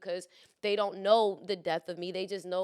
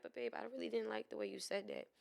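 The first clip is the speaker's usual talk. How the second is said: soft gentle; 12 dB quieter than usual. Retold or imitated. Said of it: "this one I'm just like".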